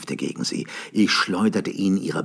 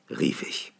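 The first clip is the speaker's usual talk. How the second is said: ordinary delivery, nothing marked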